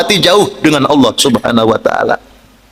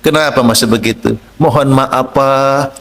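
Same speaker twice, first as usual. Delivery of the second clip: gentle speech; 7 semitones lower than usual. Shown as a demonstration. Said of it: "dengan bahasa yang lembut?".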